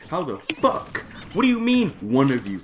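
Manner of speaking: high, loud indignant outburst; heated outrage. Retold or imitated. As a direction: blow up in disbelief. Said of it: "Ooh, I was so heated".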